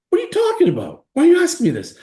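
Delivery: high, loud incredulous protest; outraged disbelief. Retold or imitated. Son protests to his mother, high and loud. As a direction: refuse to believe the question. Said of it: "son outraged at mom".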